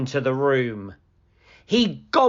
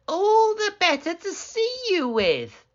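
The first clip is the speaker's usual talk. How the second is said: much higher sly granny; wolf as grandmother. Acted. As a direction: answer sweetly with menace.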